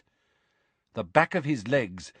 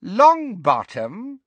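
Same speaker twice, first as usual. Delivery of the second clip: high, loud, drawn-out villainess voice; slow, high, relishing echo of a name; Bellatrix; 10 semitones higher, 6 dB louder, much slower than usual. A narrator's reading. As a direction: stretch the name into a rising question. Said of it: "repeated Bellatrix".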